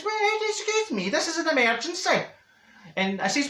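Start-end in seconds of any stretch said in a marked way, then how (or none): 0.0-2.2 high-pitched, affronted protest; indignant. Retold or imitated. Patient objects to the dentist's receptionist, indignant.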